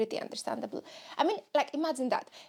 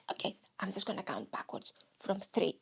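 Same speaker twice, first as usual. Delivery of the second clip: low, soft inner voice; lower voice of reason; 7 semitones lower, 6 dB quieter than usual. Acted. Inner voice counting the reluctant self in, low and firm. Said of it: "because you have a lower voice".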